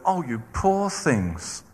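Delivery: slow, soft, mock-tender sympathy; mock pity. Preacher mocks God pitying the grumbling Israelites, slow mock-tender voice. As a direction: coo over them like a pitying nurse. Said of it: "God said".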